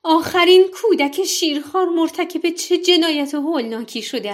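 high, loud, outraged cry at the executioner; anguished mother's shout. Acted. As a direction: scream it as a mother defending her baby. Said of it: "فریاد زد". (فریاد بلند و خشمگین مادر)

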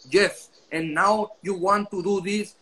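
put-on slow, heavily accented English; heavy Mexican accent in English. Professor lecturing at Harvard in accented English, slow and heavy. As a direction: imitate the ex-president lecturing in thick-accented English. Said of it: "no le entendían a la hora que hablaba".

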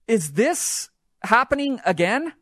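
high, slow, drawn-out disbelief; can't believe it's happening twice; stunned disbelief. Retold or imitated. Watching Hail Mary in casino.

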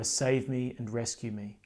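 usual speaking voice

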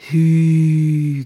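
loud, drawn-out wow; exclaiming. A narrator's reading. Nana sees the shimmering blue-green dress, exclaiming. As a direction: cry out in wonder, holding the vowel. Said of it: "kiáltotta Nana".

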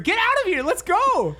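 high-pitched urgent command; acted urgent shout. Friend pulling a drunk pal from a fight, shouting. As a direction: imitate a friend yelling to leave. Friend urging him to leave.

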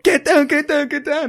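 high, loud soldier's yell; panicked under fire. Pretend. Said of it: "pretend it's Call of Duty".

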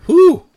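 sharp high whew from the heat; pained exhale. Real, not acted. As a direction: blow out hard against the burn. Eating spicy nut challenge.

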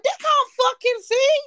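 high, loud incredulous outburst; high-pitched exclamation of comic outrage; mock disbelief. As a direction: cry it out in exaggerated disbelief. Mock outburst about huge lashes.

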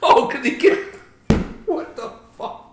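high, laughing disbelief; cracking up mid-sentence. Real, not acted.

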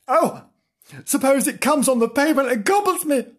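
high, fast scared kitten voice; frightened kitten. Acted. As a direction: rush out the fear. Kitten fears cars.

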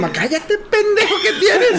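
high, slow mock scolding; mamá regañando. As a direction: scold your noisy son, half laughing. (regaño agudo de mamá imitada)

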